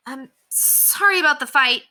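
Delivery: put-on high, hurried boyish apology; boy's sudden apology. A narrator's reading. Henry blurting an apology to Ranger's owner in a high boy's voice. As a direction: blurt it out before leaving. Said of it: "Henry said all of a sudden".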